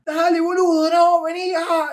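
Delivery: high, loud desperate calling; pleading shout. Retold or imitated. Stung friend calls the others over, loud and high. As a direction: beg them to come help, yelling.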